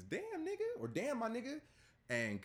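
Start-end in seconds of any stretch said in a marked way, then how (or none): 0.0-0.8 mock lip-read quote. Podcaster voicing Schroeder's lip-read words, high mock voice. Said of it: "He says". 0.9-1.6 higher put-on voice for the quoted words; mock lip-read quote. Podcaster voicing Schroeder's words, high mock voice. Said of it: "He says".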